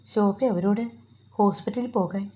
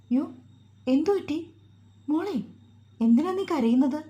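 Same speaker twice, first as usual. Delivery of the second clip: high, worried questioning; startled concern; 6 semitones higher than usual. Acted. Mother to suddenly crying daughter-in-law, startled concern. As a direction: ask anxiously in a lifted voice.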